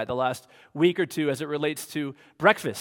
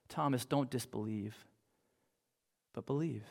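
lovingly soft and unhurried; gentle Jesus; 5 semitones lower, 7 dB quieter than usual. Acted. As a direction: invite him patiently, almost hushed. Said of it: "He's so kind. He's so gentle".

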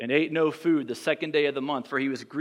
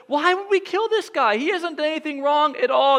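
much higher, strained pleading son; pleading Jonathan; 12 semitones higher, 4 dB louder than usual. Retold or imitated. Jonathan pleading with his father Saul, high and strained. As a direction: plead for your friend's innocence. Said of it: "And Jonathan says".

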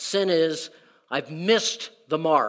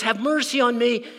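higher, pleading David; David begging for mercy, pitched up; voicing David; 6 semitones higher than usual. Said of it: "David looks up and says".

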